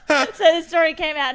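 laughing. Real, not acted. Laughing while retelling.

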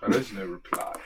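quiet, low mock echo of the line. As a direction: repeat it low and soft. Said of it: "I do like Grimsley's voice there".

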